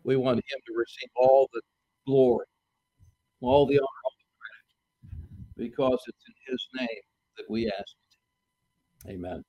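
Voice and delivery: low, reverent prayer voice; closing prayer. Real, not acted. Preacher asks in Jesus' name before the sermon, prayerful.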